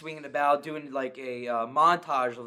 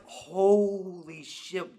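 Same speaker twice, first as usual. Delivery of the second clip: very drawn-out, awed exclamation; retold shock; 2 semitones higher, much slower than usual. Reliving the car scene for co-host, slow stunned exclamation. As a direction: stretch it out in disbelief. Retelling his shock at film.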